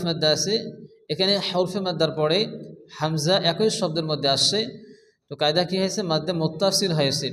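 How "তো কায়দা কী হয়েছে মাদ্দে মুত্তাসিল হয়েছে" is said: ordinary talk, nothing marked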